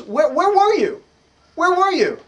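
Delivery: high, loud insistent demand; retold woman demanding at the door; demanding where he was. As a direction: pin him down with where were you.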